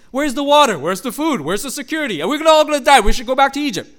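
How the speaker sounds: high, loud panicky whining; whiny complaining crowd. Pretend. Preacher mimics the Israelites complaining in the desert, loud and whiny. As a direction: whine like scared grumbling Israelites.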